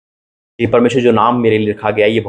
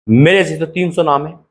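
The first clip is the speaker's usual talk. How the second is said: ordinary talk, nothing marked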